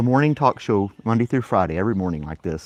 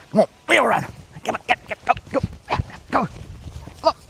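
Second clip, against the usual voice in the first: high, eager calls to the dog; excited dog call; 13 semitones higher than usual. Real, not acted. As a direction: get the dog running with you. Calling dog to run.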